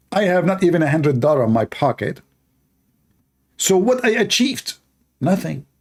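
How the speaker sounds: lightly voiced self-pitying boaster; imitating a rich man claiming he achieved nothing; mock Andrew Tate. Mimicking Andrew Tate's plea.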